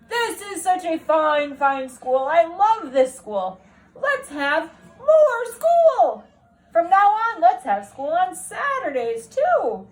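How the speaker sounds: an ecstatic principal announcing to the whole school; booming principal. Acted. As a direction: proclaim it like an overjoyed headmaster.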